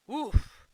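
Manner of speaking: loud, high exhaling "oof"; big puff of relief after a long job; relieved oof. Real, not acted. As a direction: blow out a loud sigh.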